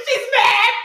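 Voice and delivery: high, loud panicked squeal; alarmed squeal. Retold or imitated. Reading the director's reply, alarmed squeal. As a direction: shriek it in panic. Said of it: "I was like".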